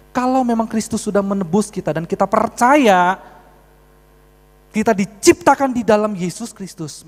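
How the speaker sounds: loud, high pulpit proclamation; forceful preaching. Real, not acted. Preacher declaring redemption to the congregation, forceful preaching.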